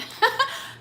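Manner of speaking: sudden high laugh; burst of laughter. Real, not acted. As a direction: let out a quick high laugh. Laughs at old YouTube memory.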